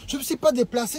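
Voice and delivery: ordinary talk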